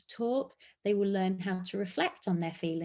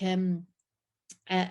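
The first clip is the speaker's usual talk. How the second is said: ordinary delivery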